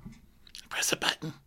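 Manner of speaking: slow, hushed whisper; mock-dramatic whisper. [name] describing her phone routine, mock-dramatic whisper. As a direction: drop to a hammed-up stage whisper.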